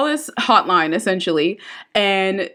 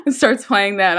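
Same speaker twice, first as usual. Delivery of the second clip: ordinary talk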